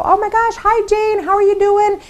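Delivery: high, gushing greeting; gushing friend. Retold or imitated. Friend picking up the phone, high gushing hello. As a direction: squeal like an old friend just called. Friend gushes on answering call.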